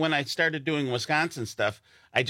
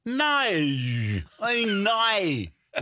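high, hugely drawn-out Australian "no"; Australian accent; 9 semitones higher, much slower than usual. Retold or imitated. Teasing how Australians say no, hammed-up Australian accent. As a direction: stretch "no" into "noy", mock Australian accent. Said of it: "like, Australian people, when they say no".

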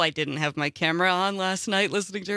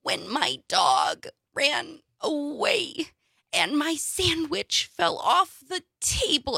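slow, whiny, overwrought recitation; whiny dramatic voice; 6 semitones higher, slower than usual. Retold or imitated. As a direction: whine it like a high school forensics kid. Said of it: "in that very whiny voice".

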